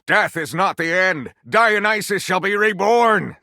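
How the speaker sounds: a prophet calling out his own rebirth; cried out. A narrator's reading. Xander cries out his prophecy of rebirth, slow and rising. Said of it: "Xander cried out".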